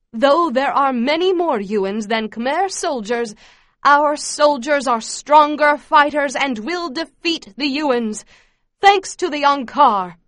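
loud, slow, high-pitched rallying speech; fervent yell. A narrator's reading. Camp leader praising the soldiers to children, yelling fervently. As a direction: hammer out the propaganda with zeal. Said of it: "Mebong yells out".

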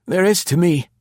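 high, wistful question; old spy longing for the village; wistful Densham. A narrator's reading. Densham plaintive.

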